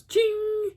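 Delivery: high ringing metallic ching; sudden high chain ring; imitated chain ching. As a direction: mimic a chain snapping taut. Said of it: "Then it goes".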